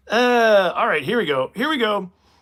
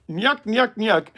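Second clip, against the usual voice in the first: high, goofy Stooge chuckle; loud cartoon nyuk-nyuk laugh; Curly; 9 semitones higher, 6 dB louder, slower than usual. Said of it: "Curly from Three Stooges".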